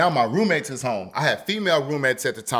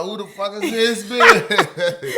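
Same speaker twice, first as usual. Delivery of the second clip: laughing disbelief; 7 semitones higher, 4 dB louder, slower than usual. Retold or imitated. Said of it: "I'm in the driver's side like".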